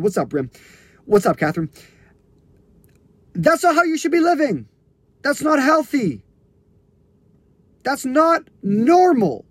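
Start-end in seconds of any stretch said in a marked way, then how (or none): 3.3-9.5 impassioned insistence. Real, not acted. Coach telling listeners this life isn't healthy, impassioned. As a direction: hammer it home, stretching the key words. Coach repeats his urgent point forcefully.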